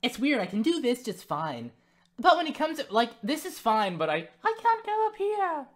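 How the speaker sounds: pushing the pitch up and up; high voice test. Shown as a demonstration. Speaker tests how high the apprentice voice can go, falsetto. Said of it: "Why do I keep trying to go up there".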